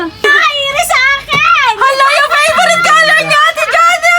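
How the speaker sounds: excited shout. Real, not acted. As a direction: build up to a shout. Host excited at prize.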